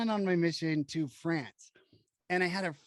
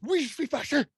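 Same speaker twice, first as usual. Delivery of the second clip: French reply; 8 semitones higher, 3 dB louder than usual. Retold or imitated. Answering in French.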